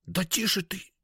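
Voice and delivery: high, hissed shushing; irritated hushing hiss. A narrator's reading. Seller silences girl on landing, high irritated hiss. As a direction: shush crossly in a thin high voice.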